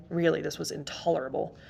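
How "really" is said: ordinary delivery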